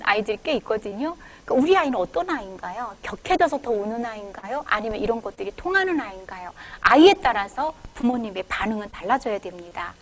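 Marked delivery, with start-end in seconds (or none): none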